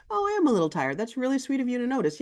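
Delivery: high, sweet thank-you; appreciative admission. Acted. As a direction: own up lightly and thank them kindly. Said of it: "the amount of the truth that's appropriate".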